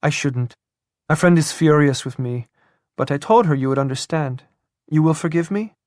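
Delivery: put-on eager, apologetic young woman pleading sweetly. A narrator's reading.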